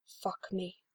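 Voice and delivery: low, soft begging; a quiet lustful plea; gravelly sexy plea. Acted. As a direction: beg it low and husky. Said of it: "in that gravelly, sexy voice".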